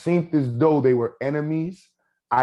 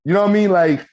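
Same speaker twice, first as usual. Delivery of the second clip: emphatic; 6 semitones higher, 7 dB louder than usual. Real, not acted. Emphatic appeal to listener.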